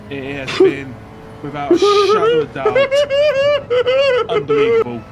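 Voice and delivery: loud and high, building up; excited shout. Real, not acted. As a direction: build to a thrilled yell. Streamer hyping a donation.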